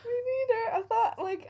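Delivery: tearful, choked up; crying, worn out. Real, not acted. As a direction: voice breaking into tears. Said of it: "I can't stop crying".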